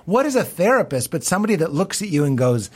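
ordinary delivery

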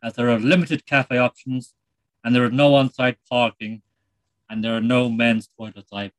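put-on light Irish accent, chatty presenter; a lightly done Irish TV host; Terry Wogan. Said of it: "It's Terry Wogan here".

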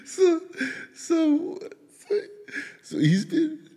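words squeezed out through high laughter; can barely speak for laughing, real. Real, not acted. Dad laughing retelling his son's joke, breaking up. Cracking up telling the story.

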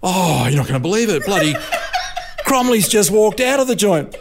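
high, giggly mock outrage; laughing gossip. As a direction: spill the news through laughter. Team bosses gossip, laughing.

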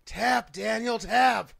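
loud, high imitated scream. Wrestler screaming at opponent in a crossface, screaming. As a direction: yell it like an opponent demanding submission. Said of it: "starts screaming".

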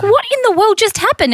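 high-pitched mock astonishment; baffled, as if jolted awake; retold bewilderment. Woken by sleepwalker, retold.

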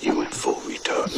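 low, breathy near-whisper; hushed verdict. Acted. Actor whispering his verdict to a fellow actor, hushed.

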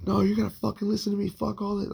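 high, quick mock yell, not full volume; retold screaming. Man retelling his drunk girlfriend's hallway outburst, mock screaming. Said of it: "starts screaming and shit".

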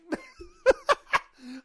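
high, loud burst of laughter; laughing. Real, not acted. Host cracking up at his own impression, laughing. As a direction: crack up loudly.

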